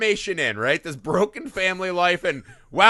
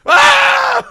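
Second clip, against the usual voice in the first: sudden high panicked yell, much louder; startled shout; 13 dB louder than usual. Retold or imitated. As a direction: yelp like you've been ambushed. Imagined reaction to child advances.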